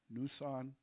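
Nusan said softly as "noo-SAHN"; trying out pronunciation. Shown as a demonstration. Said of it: "what syllable to put the emphasis on".

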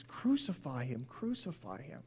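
normal talking voice

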